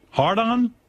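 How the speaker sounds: rising disbelief at the name; sharp jump up on "Hard on?"; incredulous question. Real, not acted.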